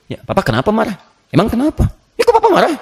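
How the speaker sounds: wife's puzzled complaint. Acted. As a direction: needle the husband, climbing to a squeal. Wife questioning angry husband. (istri bertanya kenapa papa marah, makin tinggi merengek)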